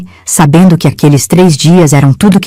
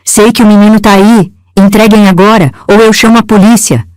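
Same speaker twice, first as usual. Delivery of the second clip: furious uncle yelling at the door; shouting; 6 semitones higher than usual. A narrator's reading. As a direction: yell it through the door as a threat.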